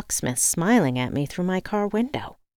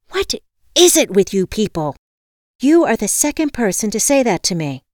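loud, higher indignant complaint; exasperated outburst; 5 semitones higher, 7 dB louder than usual. A narrator's reading. Caller fed up with meditation advice, exasperated. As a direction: snap back loudly, fed up. Irritated caller snaps back.

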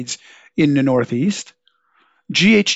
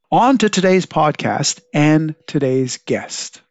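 scripted radio host read; podcast intro read; 4 dB louder than usual. Acted. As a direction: read it like a show opener.

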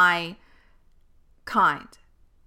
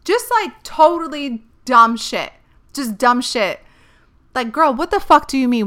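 louder, higher, fed-up outburst; fed up with people's comments, exasperated and loud; exasperated rant; 5 semitones higher, 9 dB louder than usual. Real, not acted. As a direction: vent the frustration. Ranting about dumb remarks.

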